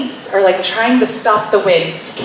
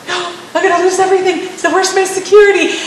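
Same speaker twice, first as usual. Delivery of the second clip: much higher, fast, panicky protest; mock panic; 11 semitones higher, faster than usual. Wife panicking over losing her security, fast and much higher. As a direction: rush it out in mock alarm. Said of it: "you're just like".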